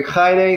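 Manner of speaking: "Hi day" as Greek "KHAI-ray", accented high on the first syllable; Greek greeting. Shown as a demonstration. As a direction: demonstrate "Hi day" with a Greek kh at the start. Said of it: "that's how you would say it".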